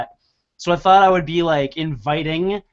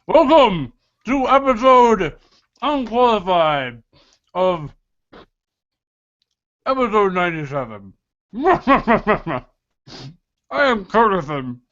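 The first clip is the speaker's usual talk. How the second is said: slow, chewing through the intro; words round a big bite; mouth full; 2 semitones higher than usual. Real, not acted. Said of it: "the biggest bite of food you possibly could".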